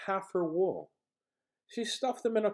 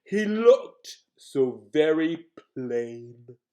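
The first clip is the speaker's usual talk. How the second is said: lion's voice; 7 dB louder, much slower than usual. Acted. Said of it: "Lion said".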